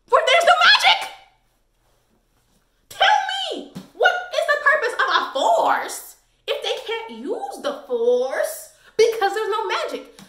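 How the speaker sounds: high, loud, mocking outrage; shrill laughing scoff at a character; incredulous rant. Real, not acted. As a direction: voice jumps high and loud in disbelief. Ranting sarcastically at Kael.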